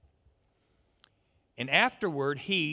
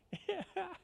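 高い声で弾ける笑い; 自分の語りに思わず吹き出す; high burst of laughter; 6 dB quieter than usual. Real, not acted. Laughs at own delivery.

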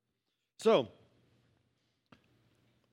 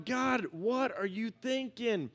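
mock exasperation; 5 semitones higher, much slower than usual. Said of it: "tripping out going".

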